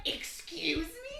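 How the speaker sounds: high, loud, drawn-out affronted question; imitated indignation. Mother to stranger lying on her son, imitated indignation. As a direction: stretch it out, mock-appalled. Mother reacts to drunk intruder.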